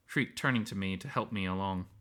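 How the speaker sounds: ordinary delivery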